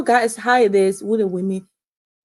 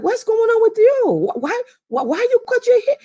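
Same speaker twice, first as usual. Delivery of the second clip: very high, shocked questioning; mother confronting daughter about the haircut, shocked; shocked mom. Retold or imitated. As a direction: gasp it out as a stunned mother. Mom reacting to cut hair.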